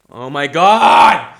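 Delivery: slow, loud, hammed-up exasperation; exasperated outburst. Shopper who cannot open the plastic bag, exasperated yell. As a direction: build to a drawn-out mock yell.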